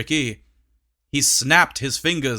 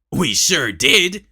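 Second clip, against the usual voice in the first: proud; 3 semitones higher, 5 dB louder than usual. A narrator's reading. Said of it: "said Tricky proudly".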